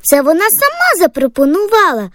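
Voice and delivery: put-on proud high girlish chatter; brag, puffed up with pride; bursting with pride. Girl tells mom how the teacher praised her, bursting with pride. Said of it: "Дівчинку просто розпирало від гордості".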